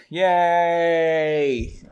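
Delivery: loud, held-out cheer; mock cheer. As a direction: cheer the break with one long note. Cheering the drinking break.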